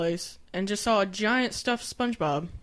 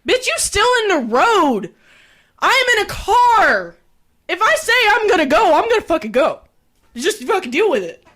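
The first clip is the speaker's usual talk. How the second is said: angry yelling; 8 semitones higher, 7 dB louder than usual. Retold or imitated. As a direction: yell it at the pedestrian from the car. Retold angry outburst at pedestrian.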